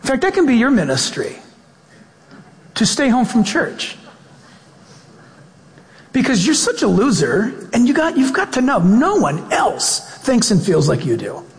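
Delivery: lightly acted sneering accuser; mock taunting. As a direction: needle them with pointed, high-pitched mock advice. Acts out taunting discouraging voice.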